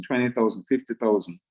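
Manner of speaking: normal talking voice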